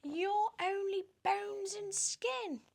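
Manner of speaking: very high, slow, preening voice; high, silly show-off voice; vain Aunt Sponge. Acted. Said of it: "Aunt Sponge cried out".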